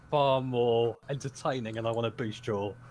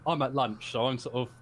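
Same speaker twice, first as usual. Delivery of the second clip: ordinary talk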